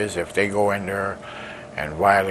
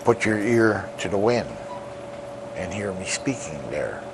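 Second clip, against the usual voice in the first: solemn poem recital; 3 semitones higher than usual. Acted. Lakota elder recites his poem to the world, solemn declamation. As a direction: proclaim each line like a chant to the people.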